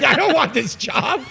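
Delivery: high, loud laughing refusal; laughing protest. Retold or imitated. Telling friend how he refused the HOA job, laughing loud. Reluctantly taking over as president.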